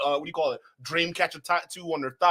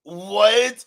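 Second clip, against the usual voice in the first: loud, rising, caught off guard; loud disbelieving what; startled what; 4 semitones higher, 9 dB louder than usual. Real, not acted. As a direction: shout it in disbelief. Streamer startled by clip claim.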